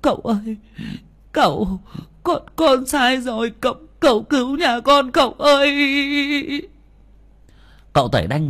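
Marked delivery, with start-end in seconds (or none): 0.0-6.7 desperate pleading. A narrator's reading. Kneeling woman begs the master to save her family, desperate. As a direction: beg for mercy, voice breaking high. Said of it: "liên tục van xin".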